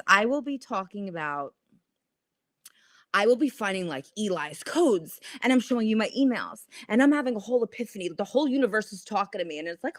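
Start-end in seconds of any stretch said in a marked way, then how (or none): none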